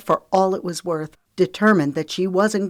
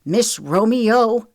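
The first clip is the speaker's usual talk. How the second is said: higher, loud, slow contempt; spat; 6 semitones higher, 5 dB louder, slower than usual. A narrator's reading. Trent turns on Lexi indignantly, spitting it. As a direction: spit out the name. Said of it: "he spat".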